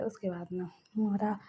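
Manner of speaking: plain reading voice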